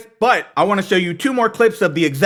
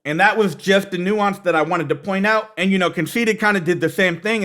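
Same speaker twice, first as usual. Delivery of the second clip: ordinary talk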